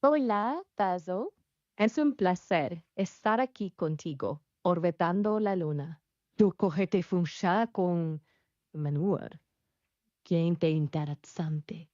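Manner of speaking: speaking Spanish with a Spanish accent; conversational Spanish accent, lightly performed. Shown as a demonstration. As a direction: demonstrate a Spanish voice. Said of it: "uh, Spanish?".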